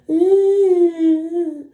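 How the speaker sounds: loud high hum. Pretend. Hums high between lines.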